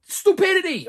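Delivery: high, loud burst of disbelief; exasperated cry. Real, not acted. Reviewer scoffs at the movie characters, exasperated outburst. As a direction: throw the word out in exasperation.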